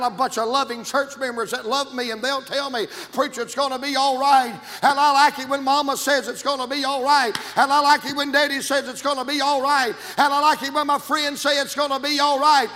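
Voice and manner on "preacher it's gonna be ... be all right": louder and higher, building to a cry; building to a shout. Acted. Preacher rousing the congregation, building to a loud shout. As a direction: climb to the climax.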